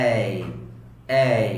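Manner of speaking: এই said softly from mid-throat. Shown as a demonstration. Teacher drilling the letter A, soft mid-throat sound. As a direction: model a soft gliding এই, spaced out. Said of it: "it's একদম soft".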